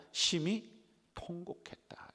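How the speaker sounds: 심히 cried out high, then 통곡했다 hushed; preacher's dramatic emphasis; emphatic reading. Shown as a demonstration. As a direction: rise hard on 심히, drop to a hush. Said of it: "어떻게 했다?".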